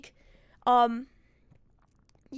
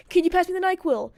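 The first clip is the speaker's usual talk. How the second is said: higher, louder retold demand; mock fed-up plea, pitched up; exasperated request; 6 semitones higher, 5 dB louder than usual. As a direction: ask it insistently, out of patience.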